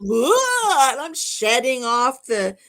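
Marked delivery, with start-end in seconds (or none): 0.0-0.9 loud, high grossed-out groan; disgusted groan. Retold or imitated. Wife groaning in disgust after the spider is gone. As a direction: shake off the creepy feeling. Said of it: "I'm out".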